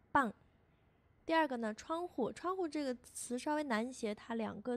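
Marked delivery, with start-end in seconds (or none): 0.1-0.3 棒 with a hard, tense, louder b; teacher punching out a heavy 棒; heavy tense consonant. Shown as a demonstration. Teacher showing students the tense b, heavy and strong. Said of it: "注意我的发音是很重的".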